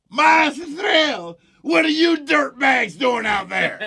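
high yelled heckle from the crowd; mock outraged yell at two intruders; shout. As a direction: yell it across the field, high and stretched. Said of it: "I always remember this".

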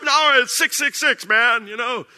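loud, high mock worried voice; a hint of a scared cashier. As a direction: play the superstitious cashier. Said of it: "And I'd be like".

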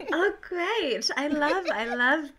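high, mock-delighted oh great; sarcastic. Real, not acted. Co-host asks about motherhood, sarcastic delight. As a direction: fake enthusiasm at a dreaded topic.

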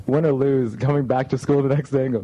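ordinary talk, nothing marked

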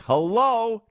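mock surprise. Mock exclamation in ad.

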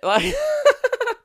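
high, cackling witch laugh; creepy witch cackle. Real, not acted. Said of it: "that laugh was creepy I just did then".

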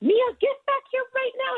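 put-on high-pitched scolding mother with Haitian accent; shrill-pitched retold yell, Haitian-accented; imitated mom's yell. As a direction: mimic your mom yelling across the store. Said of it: "she would just yell at me".

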